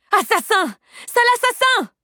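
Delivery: very high, loud accusing shriek; hate-filled scream. A narrator's reading. Unseen woman screams at the killer, full of hate. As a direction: scream the insult with vibrant hatred. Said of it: "hurla-t-on".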